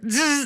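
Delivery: buzzy imitated zap; a mock electric zap; bug zapper zap. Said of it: "all of a sudden you hear".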